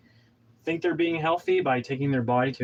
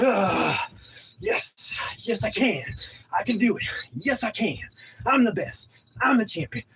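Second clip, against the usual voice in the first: self-pumping cheer; 7 semitones higher than usual. Shown as a demonstration. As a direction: cheer yourself on like a champion. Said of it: "really cheer".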